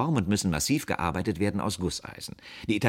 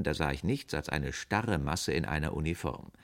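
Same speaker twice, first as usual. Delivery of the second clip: usual speaking voice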